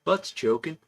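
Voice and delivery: a light imitated Texas twang, deadpan; Texas accent. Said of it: "that Texas accent and said".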